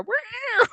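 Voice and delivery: high-pitched flung-cat yowl; imitated cat meow. Cat flung from the tree, high imitated meow. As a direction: mimic a flying cat's meow. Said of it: "You see the cat go".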